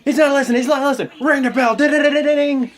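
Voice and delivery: high, loud mock referee yelling; hammed-up frantic ref calling for the bell; panicked ref. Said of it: "the ref was like".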